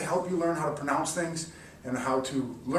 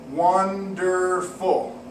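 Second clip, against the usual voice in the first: "Wonderful" very slow, "Won-der-ful"; slow syllable split; 3 semitones higher, 4 dB louder, much slower than usual. Shown as a demonstration. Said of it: "you clap three times".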